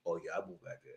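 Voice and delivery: low lazy mumble, mocking a lie; mock husband. Vlogger mocking the husband's claim, in a low lazy mumble.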